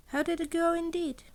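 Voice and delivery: plain reading voice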